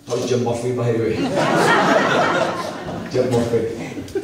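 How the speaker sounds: ordinary talk, nothing marked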